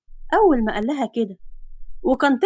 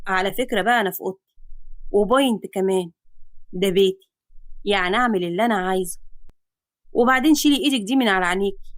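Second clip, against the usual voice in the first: lower, fast man's voice; cocky hero; 4 semitones lower than usual. Acted. Man in his own room brushing her off.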